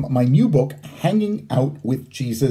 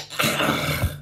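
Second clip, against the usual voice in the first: heavy sigh; 5 dB quieter than usual. Acted. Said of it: "I sighed".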